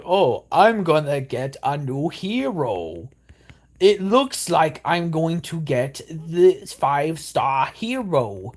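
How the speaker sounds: slow, hammed-up game ad announcer; mock ad hero voice; cringe ad voice. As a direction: drag it out like a cheesy mobile ad. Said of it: "they talk like this".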